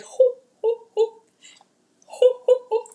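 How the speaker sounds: high loud hoo calls; loud high owl-like hoots; hooting call. Real, not acted.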